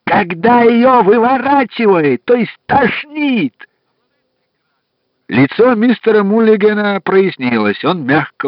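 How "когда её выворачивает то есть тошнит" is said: slow, high, loud bellow; roaring. Acted. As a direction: roar it at a deaf man. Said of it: "набрал воздуху в лёгкие и взревел".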